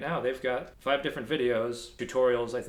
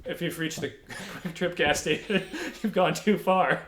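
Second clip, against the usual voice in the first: laughing, louder and higher hammed-up local ad voice; mock local ad; 6 semitones higher, 4 dB louder than usual. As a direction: sell the directions like a cheesy small-town commercial. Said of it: "like a local advertisement".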